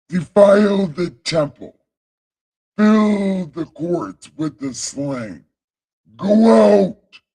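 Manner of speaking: high, loud divine command; God. Acted. God sending the men out to kill, loud commanding shout. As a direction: build to a big shouted order. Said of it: "So then he said".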